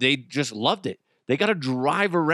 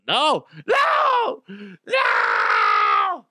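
horrified screaming no; 5 semitones higher, 4 dB louder, much slower than usual. Pretend. Horrified refusal.

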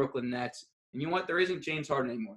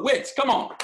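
ordinary talk, nothing marked

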